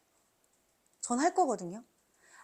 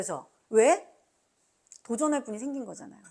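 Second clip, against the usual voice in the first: ordinary talk, nothing marked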